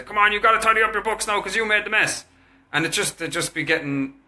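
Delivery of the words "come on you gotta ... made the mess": high, pushy nagging; nagging parent. Retold or imitated. Father nagging sons to tidy their mess, pushy. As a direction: push the kids to tidy, exasperated. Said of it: "I would say".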